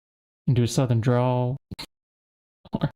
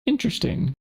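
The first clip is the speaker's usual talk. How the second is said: high mock interesting; 10 semitones higher than usual. Shown as a demonstration. Second take much higher.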